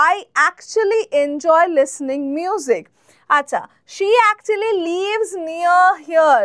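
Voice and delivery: very slow, clear classroom read; slow, careful teacher example; slow example sentence. Shown as a demonstration. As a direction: spell it out slowly for learners. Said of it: "example sentence".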